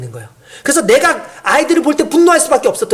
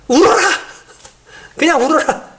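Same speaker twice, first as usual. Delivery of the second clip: mock call, pitched up at first; dismissive parent; 5 semitones higher than usual. Neglectful parent brushing off a crying child, mock dismissive command. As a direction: toss it off like a parent who's done.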